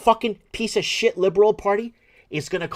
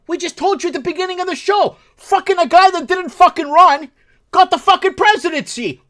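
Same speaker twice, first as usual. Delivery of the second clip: higher, louder, shouting in disbelief; angry shouting; 11 semitones higher, 10 dB louder than usual. Real, not acted. Host raging to listeners about a stolen presidency, angry shouting. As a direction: rail at it at the top of your voice. Escalating rant on election.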